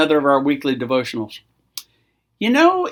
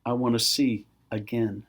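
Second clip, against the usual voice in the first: quieted Bartimaeus; 6 semitones lower, 8 dB quieter than usual. Acted. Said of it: "Bartimaeus saying".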